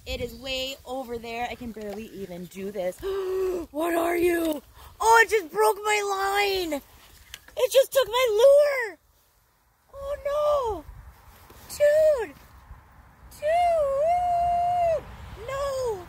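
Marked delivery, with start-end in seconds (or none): 3.0-3.7 sudden shocked high gasp; sharp gasp. Real, not acted. Sharp shocked gasp as the big fish breaks his line. 3.7-16.1 much higher, much louder anguished cries; real shocked dismay. Real, not acted. Anguished high yelling as the big fish takes his lure.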